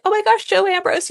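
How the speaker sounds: high, gushing swoon over a crush; swooning fangirl. Retold or imitated. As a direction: squeal it like a smitten teen reader.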